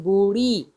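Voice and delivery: "बूढ़ी" with a retroflex flap; flapped ढ़. Shown as a demonstration. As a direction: flick the tongue in "बूढ़ी". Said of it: "हम बोल रहे हैं".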